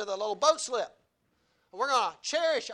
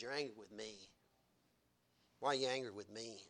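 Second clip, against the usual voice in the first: much softer and lower, hushed; quiet rebuke; 10 semitones lower, 11 dB quieter than usual. Acted. Jesus to the angry churchgoer, hushed and low. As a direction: speak it softly, like a wounded friend. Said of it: "Jesus says".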